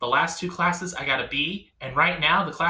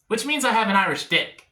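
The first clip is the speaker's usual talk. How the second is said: ordinary talk, nothing marked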